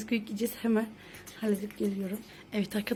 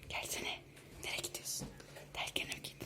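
whispering; 13 dB quieter than usual. Real, not acted. Sneaking to tickle the sleeper.

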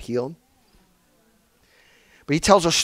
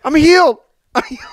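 high, loud joyful cry of I'm healed; excited shout; 11 semitones higher, 6 dB louder, faster than usual. Retold or imitated. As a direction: shout it out overjoyed. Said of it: "gets up, says".

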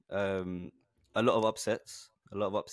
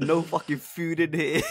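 laughing mock rule; 6 semitones higher, 5 dB louder, slower than usual. Laughingly quoting a house rule.

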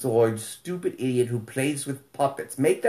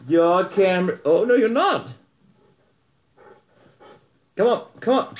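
high, louder coaxing; coaxing dog; 6 semitones higher, 4 dB louder than usual. Real, not acted. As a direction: cheer the shy puppy on.

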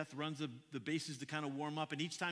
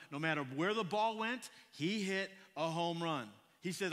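ordinary talk, nothing marked

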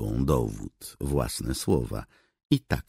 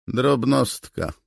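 distant reply; 11 semitones higher, 6 dB louder, slower than usual. Acted. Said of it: "a potem odległe".

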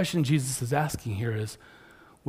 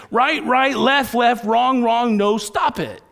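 high, loud barrage of commands; pastor coach yelling; 8 semitones higher, 8 dB louder, slower than usual. Retold or imitated. Pastor coach drilling a struggling churchgoer, yelling. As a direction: yell corrections without a break. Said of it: "the pastor coach keeps yelling at me".

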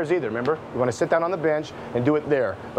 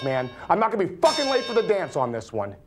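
pitched much higher, racing and wound up; manic rant; 10 semitones higher than usual. Acted. Man ranting about the money and the danger, manic and wound up. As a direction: spill it out like a paranoid man spinning. Long paranoid rant held high.

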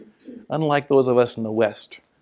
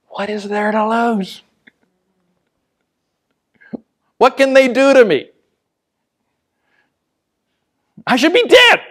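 building higher and louder to a cry; rising fervour; 8 semitones higher, 6 dB louder than usual. Acted. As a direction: build from question to near-shout.

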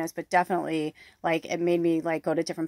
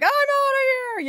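high, loud "I'm outta here!" called out; a joyful, defiant shout of freedom; triumphant exit; 4 dB louder than usual. Retold or imitated. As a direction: throw it over your shoulder as you walk out. Quitting job, Norma Rae moment.

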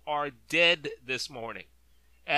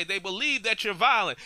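ordinary talk

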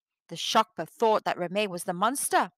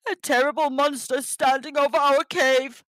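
trembling, fearful creature voice; a scared snow creature warning his wife; frightened Shokpa; 3 semitones higher, 4 dB louder than usual. A narrator's reading. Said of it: "began to tremble with fear".

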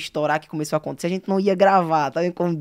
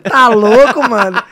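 loud, higher-pitched mock disbelief; incredulidade encenada; 4 semitones higher, 13 dB louder, slower than usual. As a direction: blurt it out like a disbelieving mate. Acts out the guys' disbelief. (incredulidade exagerada, em voz alta)

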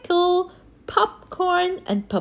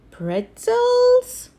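surprised; 9 semitones higher, 4 dB louder than usual. Acted. Gang puzzled by new topping.

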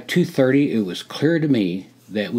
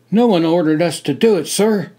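at attention; 7 semitones higher, 5 dB louder than usual. Acted. Hawk answering officer at attention.

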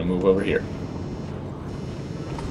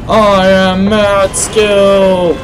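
gloating shout; 9 semitones higher, 6 dB louder, much slower than usual. Real, not acted. Gloating after a kill.

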